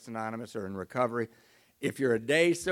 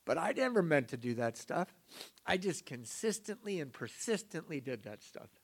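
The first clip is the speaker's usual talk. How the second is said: normal talking voice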